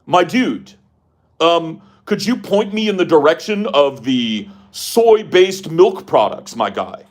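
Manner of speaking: high, loud mock announcer asking politely; Vosh. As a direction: stretch the words out in a pompous broadcaster voice. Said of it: "go like".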